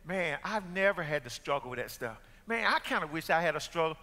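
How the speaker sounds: low, soft mock wistful complaint; sheltered young believer. Pastor imitates young people envying others' sin, low wistful. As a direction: play a churched kid wishing for struggle. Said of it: "and they say".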